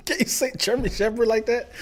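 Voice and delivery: normal talking voice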